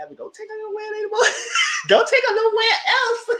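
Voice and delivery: high, loud laughing plea; laughing shout. Retold or imitated. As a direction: beg loudly through laughter.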